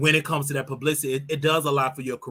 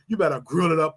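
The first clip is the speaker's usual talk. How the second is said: usual speaking voice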